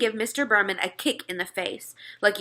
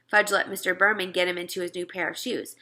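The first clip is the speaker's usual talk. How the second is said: plain reading voice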